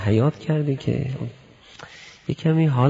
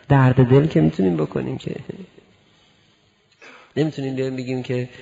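normal talking voice

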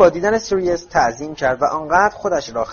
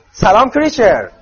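high, booming hello; very loud greeting; 8 semitones higher, 8 dB louder than usual. A narrator's reading. Fred greeting the house-elf who ignores them, very loud. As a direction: call it out very loudly. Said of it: "با صدای خیلی بلند گفت".

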